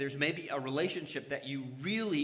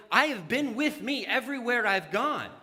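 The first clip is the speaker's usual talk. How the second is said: rising, louder exclamations of self-disbelief; mock stunned outburst getting louder; shocked disbelief; 5 semitones higher, 7 dB louder than usual. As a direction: act out the shock at your own spending. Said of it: "and you're like".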